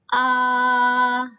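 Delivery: A held high and level; level high first tone. Shown as a demonstration. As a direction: sing A flat and high. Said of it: "itu bunyinya".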